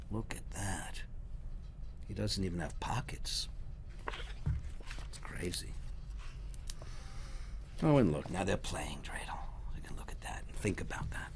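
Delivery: hushed whisper to the listener; whispered aside. Real, not acted. Reader whispers comments to the children, hushed. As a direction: lean in and whisper a secret.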